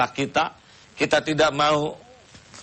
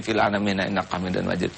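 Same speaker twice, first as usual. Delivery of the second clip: mock rushed prayer; 5 semitones lower, faster than usual. Kiai mimics worshippers rushing their prayer, fast mumbled recitation. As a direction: gabble the closing prayer fast and low. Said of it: "saking cepatnya begini".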